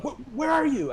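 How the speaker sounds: high-pitched imitation of urgent curiosity; mock eager question. Label boss quizzing a new neighbour, imitated high and eager.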